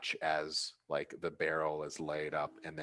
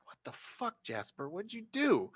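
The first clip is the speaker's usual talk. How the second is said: shocked quote; 13 semitones higher than usual. Retold or imitated. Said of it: "I was like".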